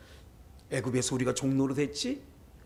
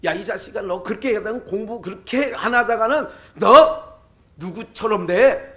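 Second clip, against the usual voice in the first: loud mock yell at a lazy son; parent yelling at kid; 6 semitones higher, 10 dB louder than usual. Parent yelling at a son who won't study, loud mock scolding. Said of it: "한 번 더 얘기하죠".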